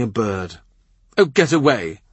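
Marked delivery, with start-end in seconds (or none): 1.2-2.0 louder irritated snap; a sharp, impatient queen pushing her sister away; snapping. Acted. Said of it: "the anointed queen snaps".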